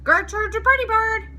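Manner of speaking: high, loud squawking parrot voice; a parrot showing off in the pet shop; parrot scream. A narrator's reading. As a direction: shriek it like a proud parrot. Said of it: "screamed Gertrude".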